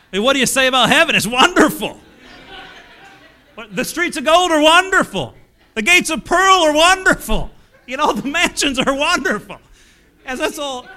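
loud, high, hammed-up preaching of his first sermon; mock young preacher. Young preacher to nursing home crowd, loud mock preaching. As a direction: belt out every wonderful like a green preacher. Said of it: "I think I said wonderful".

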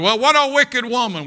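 high, loud mock condemnation; judgmental outcry. Mimics judgmental people condemning her.

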